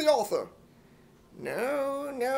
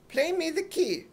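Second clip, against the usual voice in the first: usual speaking voice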